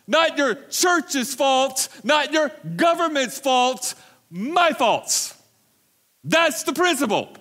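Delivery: high, loud, slow hammering preacher; preacher pounding the point; full-force preaching. Acted. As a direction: drive each name home slowly.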